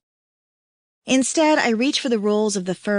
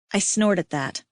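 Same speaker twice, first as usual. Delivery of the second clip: ordinary delivery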